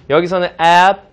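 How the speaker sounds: "ab" pronounced with an e sound; stress on ab. Shown as a demonstration. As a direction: lift and hit "ab" clearly. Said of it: "에 발음이 가깝게 나죠".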